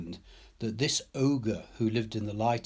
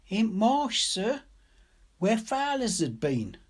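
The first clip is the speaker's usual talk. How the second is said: put-on high, timid child's voice, pitched well up; a scared twelve-year-old pleading for her bird; frightened little girl; 12 semitones higher, 4 dB louder than usual. A narrator's reading. Narrator voicing the scared girl, high and timid.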